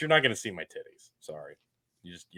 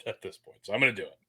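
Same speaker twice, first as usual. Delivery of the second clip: normal talking voice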